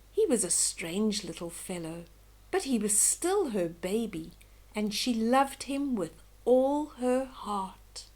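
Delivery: plain reading voice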